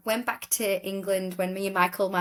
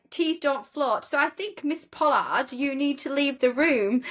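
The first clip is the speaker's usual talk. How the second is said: high, mock-stern judge voice; imitating the judge; 6 semitones higher than usual. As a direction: put on a pompous judge for the punchline. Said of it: "and he's like".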